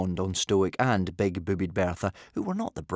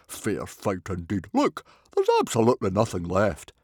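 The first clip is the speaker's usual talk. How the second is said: mild disgust; 3 dB louder than usual. A narrator's reading. Stoic finding the oil pot empty, mildly disgusted. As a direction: grumble scornfully about being cheated. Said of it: "tut-tutting in mild disgust".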